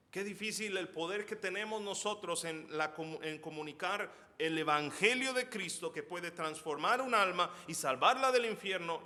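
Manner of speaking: loud, high, impassioned sermon voice; fiery preaching. Acted. Pastor urges believers to share the gospel, fiery. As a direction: plead with the congregation, full force.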